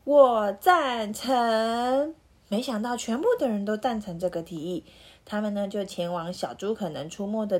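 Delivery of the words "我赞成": shouted together, slow and loud; all shout agreement at once; loud in unison. A narrator's reading. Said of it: "大声的异口同声的说".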